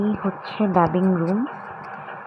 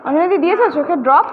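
calling out; 8 semitones higher, 6 dB louder, faster than usual. Real, not acted. Calling across to colleague.

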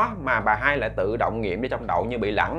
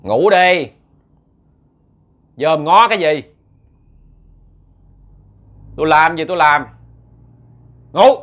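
loud, harsh scolding, a higher voice; furious husband barking at his mother-in-law; angry scolding; 4 semitones higher, 9 dB louder than usual. Acted. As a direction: snap at her to go to sleep. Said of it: "Bà nạt nộ bà hai vậy đó".

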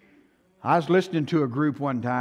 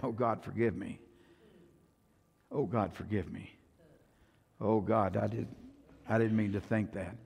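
much softer, pleading confession; half-muttered plea for forgiveness; murmured prayer; 8 semitones lower, 9 dB quieter than usual. Retold or imitated. Preacher voices his own confessions.

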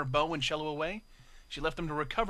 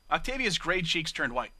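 ordinary delivery, nothing marked

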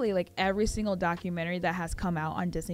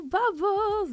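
sing-song greeting; 13 semitones higher, 4 dB louder than usual. Real, not acted.

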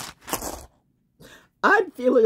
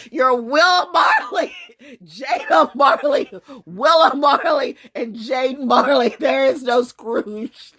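loud, higher, drawn-out name-calling; mock proclamation; 6 semitones higher, 5 dB louder, slower than usual. Real, not acted. Streamer renames the family after Marley, mocking proclamation. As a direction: proclaim each name slowly and loudly.

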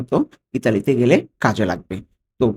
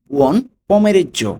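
Buon pomeriggio drawn out slowly with higher pitch; 7 semitones higher, 4 dB louder, much slower than usual. Shown as a demonstration. (ধীর ও উঁচু স্বরে উচ্চারণ)

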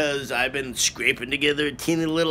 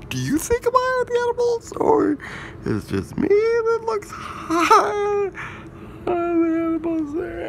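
high mirror voice. Acted.

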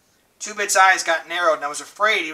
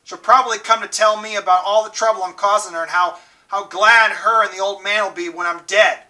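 louder, higher bitter complaint; bitter raised voice; 3 semitones higher, 6 dB louder than usual. A narrator's reading. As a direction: spit out the resentment. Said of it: "His voice was rising".

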